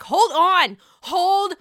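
loud, high mock shout, escalating; fed-up yelling. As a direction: yell over a brawl like an exasperated host. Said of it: "Andy just can't take it".

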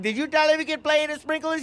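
high-pitched mock-mom interrogation; accusing mom. Mom confronts dad over sprinklers, mock accusing. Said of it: "he's scared of my mom".

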